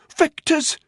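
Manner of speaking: high, strained gasp; cracking voice. A narrator's reading. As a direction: gasp the word out in pain. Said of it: "His voice was cracking badly".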